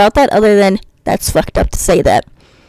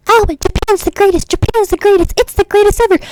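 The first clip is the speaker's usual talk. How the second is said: high, gushing parody chant, much higher; mock screaming weeb; 10 semitones higher than usual. Mocking fans who insist Japan is the greatest, high raving parody. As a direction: ham up a fan raving about Japan. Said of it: "scream at me".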